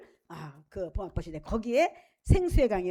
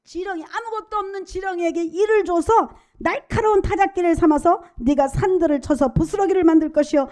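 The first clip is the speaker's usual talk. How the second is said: loud, rising climax of the sermon; fervent preaching; 5 semitones higher, 10 dB louder than usual. Acted. Preacher to congregation about God's strength, fervent loud preaching. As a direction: preach it out with full force.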